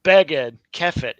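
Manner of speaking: "Beged", "Kefet", each punched on its first syllable; first-syllable stress. Shown as a demonstration. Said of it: "Because it's accented".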